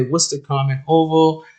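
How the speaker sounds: ordinary talk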